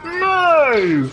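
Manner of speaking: real anger at the game; frustrated shouting. Real, not acted. Player angry at game.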